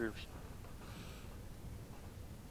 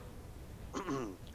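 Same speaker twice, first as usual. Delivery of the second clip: throat clearing; 6 semitones higher, 9 dB quieter than usual. Acted. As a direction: clear your throat before a speech. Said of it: "Ector's gonna clear his throat".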